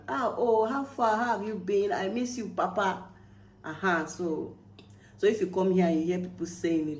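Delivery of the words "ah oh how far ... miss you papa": happy greeting. Acted. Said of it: "So you understand?".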